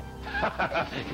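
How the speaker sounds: quick high cackle; horror host cackle. Acted. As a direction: laugh at your own bad pun. Laugh at own pun.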